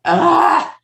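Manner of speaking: loud, high groan of dread; exasperated cry. Retold or imitated. Woman sees his office calling, loud exasperated groan.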